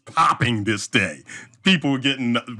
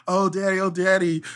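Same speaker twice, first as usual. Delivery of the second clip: imitating crying kids; 7 semitones higher than usual. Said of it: "they're crying".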